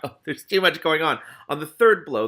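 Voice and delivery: plain reading voice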